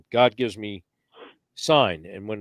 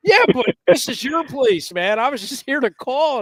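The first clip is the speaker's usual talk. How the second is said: excited protest; 10 semitones higher, 6 dB louder than usual. Retold or imitated. Arguing who should shoot.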